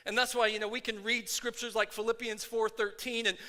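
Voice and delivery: preaching at full force. Acted. Pastor firing up his church, preaching at full force. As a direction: stir the congregation up, preaching hard.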